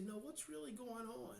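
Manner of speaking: slow down and ask it softly; gentle quiet probe past the smile; slowed-down quiet question. Shown as a demonstration. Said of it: "if you'll slow down and you'll say".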